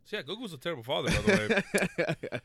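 giggling the joke out; laughing as he teases about a cartoon dad. Real, not acted. Laughing jab at Goku.

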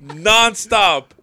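loud, high, slow exasperated rant. Real, not acted. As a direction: vent about the endless texts. Ranting about nonstop texts.

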